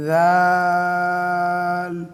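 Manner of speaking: long chanted দাল; drawn-out dal. Shown as a demonstration. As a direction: stretch দাল four alif lengths. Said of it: "চার আলিফ টান হবে".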